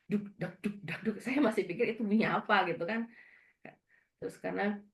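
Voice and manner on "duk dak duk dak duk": rhythmic spoken thump of a heartbeat; short, even "duk dak" beats; imitated heartbeat. Patient imitates the ultrasound heartbeat, rhythmic imitated thumping. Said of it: "ada bunyi denyut jantung kan".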